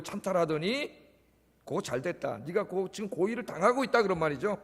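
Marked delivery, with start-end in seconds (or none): none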